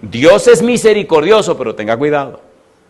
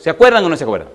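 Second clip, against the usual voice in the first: ordinary delivery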